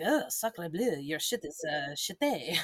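hammed-up French accent, laughing at the end; a silly fake French accent; mock French accent. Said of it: "like, France".

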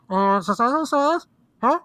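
high, confused drive-thru clerk grunts; puzzled clerk who can't follow the order. Retold or imitated. Host imitating Cage's drive-thru clerk for his co-host, high and confused. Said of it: "how Cage does the, the Drive-Thru voice".

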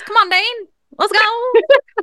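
very high silly rallying call; hyped-up cousins' voice. Pretend. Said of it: "that's the voice me and my cousins do".

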